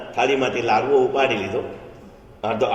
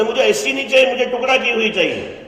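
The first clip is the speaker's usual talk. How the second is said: put-on high, loud childish whine; stubborn child crying for cut sugarcane; whining child; 10 semitones higher, 3 dB louder than usual. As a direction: wail like a stubborn little boy. Birbal plays wailing child.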